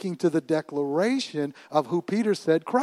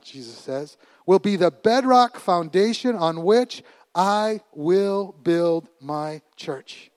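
very slow, stretched declaration; preaching proclamation; 2 semitones higher, 4 dB louder, slower than usual. Acted. Jesus to Peter, slow booming declaration. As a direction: lay down each word like bedrock.

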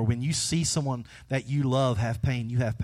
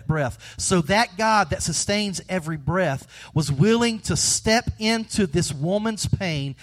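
ordinary talk

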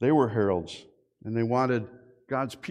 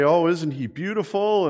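gushing grandparent; 5 semitones higher, 5 dB louder than usual. Retold or imitated. Gushing over a grandchild photo.

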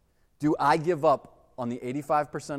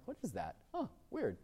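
puzzled whisper; 5 semitones higher, 16 dB quieter than usual. Retold or imitated. Confused whispered aside.

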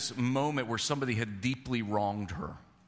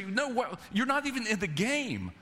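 incredulous; 10 semitones higher than usual. Pretend. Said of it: "you would say".

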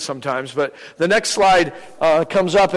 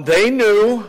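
short, sharp and louder; curt insistence; 6 semitones higher, 5 dB louder than usual. Retold or imitated. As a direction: snap it back with certainty. Caller insists on phone.

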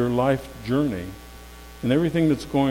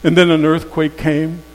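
usual speaking voice

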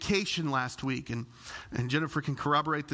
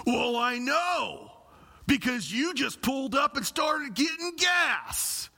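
clerk tearing into him; 8 semitones higher, slower than usual. Retold or imitated. Gas station clerk scolding the pastor, mock angry shout. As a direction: snap at him like an irritated clerk. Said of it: "she begins to tear into me".

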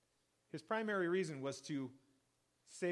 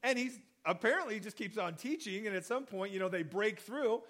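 ordinary talk, nothing marked

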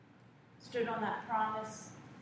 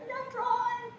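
squeaky, much higher whine; whiny child; 4 dB louder than usual. Retold or imitated. Daughter whining back at her lecturing dad, very high. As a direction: whine it like a little girl. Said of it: "I go".

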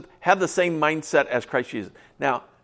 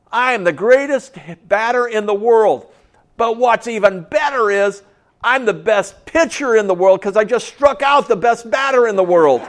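put-on kid shouting; 7 semitones higher, 6 dB louder than usual. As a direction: yell it to the whole yard like a kid sure of himself. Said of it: "raises his hands up and says".

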